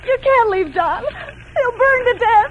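crying plea. Acted. Wife begs the outlaw not to leave her husband, crying. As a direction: beg through tears.

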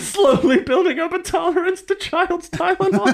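high giggly line through laughter; laughing. Real, not acted. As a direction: laugh the words out. Mock joke while laughing.

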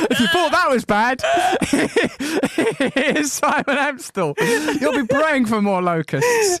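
high, loud, laughing introduction; mock stage compere. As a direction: announce the act with gleeful, drawn-out menace. Acting the disastrous host's introduction, amused.

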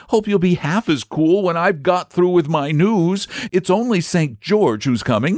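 put-on high, excited young boy; a cheeky lad teasing; eager boy voice. A narrator's reading.

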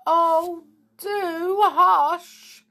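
high, loud, wailing exasperation; distressed plea. A narrator's reading. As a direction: cry out over the squabbling goblins. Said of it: "said poor Dame Sensible".

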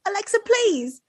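British accent mimicking the friend. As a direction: imitate the British begging. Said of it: "You do it so good".

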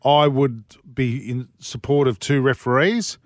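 ordinary talk

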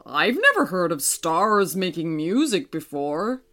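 put-on kindly old moon, chuckling. Moon speaks.

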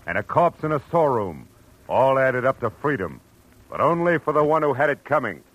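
dramatic radio opener. Acted. Radio narrator warning listeners about crime, weighty. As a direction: intone the grim moral like a radio host.

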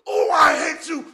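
high crying shout, slow; hammed-up tantrum shriek; mock raging gamer. Imitating the angry gamer screaming over the mic.

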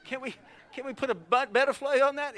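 rising comic worry over the cost; inwardly worried dad. Acted. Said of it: "Inside we're like".

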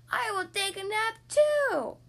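high, slow curious little pig. Acted. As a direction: ask it wide-eyed, drawn out. Piggy voice.

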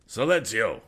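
loud, sharp command to be silent; scowling officer shouting Silencio!; angry exclamation. A narrator's reading. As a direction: bark it down at the prisoner. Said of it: "exclaimed the lieutenant, scowling".

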